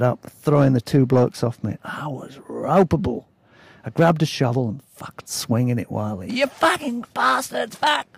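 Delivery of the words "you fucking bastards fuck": much higher, slower enraged curse; furious outburst. Retold or imitated. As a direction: lash out in a rage. Furious while swinging a shovel.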